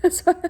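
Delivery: real high laugh bursting out; high giggling laugh. Real, not acted. Cracking up at her donut joke, high giggling. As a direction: giggle at your own joke.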